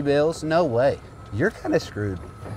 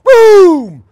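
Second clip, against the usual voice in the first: full-volume celebratory shout, high and falling; triumphant yell; 17 dB louder, much slower than usual. Real, not acted. Golfer celebrating a made shot on a bet, yelling in triumph. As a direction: roar it out in victory.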